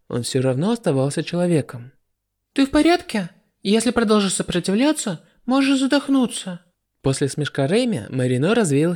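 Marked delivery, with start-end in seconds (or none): 2.5-6.6 high, falsely caring taunt; mocking girl voice. A narrator's reading. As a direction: ask sweetly while enjoying his trouble. Reimi taunts trapped Marino.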